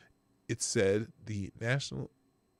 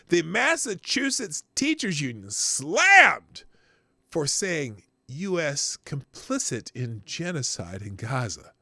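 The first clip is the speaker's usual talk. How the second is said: slow emphatic read; 4 semitones higher, 5 dB louder, slower than usual. Acted.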